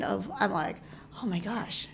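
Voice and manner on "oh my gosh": soft, impressed aside; retold quiet swoon; hushed admiration. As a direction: say it under your voice, as if quietly awed. Retold awe at caller's architecture talk.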